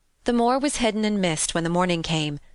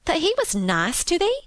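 high, disbelieving question; incredulous Yorkshire maid; astonished cry; 11 semitones higher than usual. A narrator's reading. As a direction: marvel with the pitch right up. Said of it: "cried Martha with wide open eyes".

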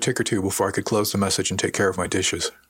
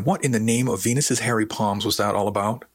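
usual speaking voice

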